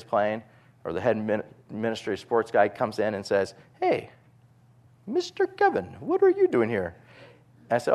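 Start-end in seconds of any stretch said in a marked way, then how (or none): 3.8-7.0 put-on high, lightly accented greeting voice; imitated Sudanese official. Sports official surprised to see the coach, high voice.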